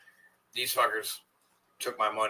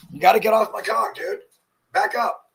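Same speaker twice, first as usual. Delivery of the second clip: high, louder, exasperated at the dog; talking to a dog; 11 semitones higher, 4 dB louder than usual. Real, not acted. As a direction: push the pushy dog back. Dog won't leave him alone.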